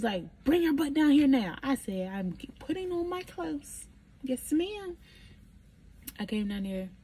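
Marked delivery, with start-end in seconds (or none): 0.4-1.6 high, loud auntie order; raised voice, no arguing; bossy summons. Retold or imitated. Imitating Aunt Doreen ordering her over. 2.1-5.0 small high-pitched compliance; acting the hurried, dutiful youngster; obedient reply. Retold obedient reply to the order.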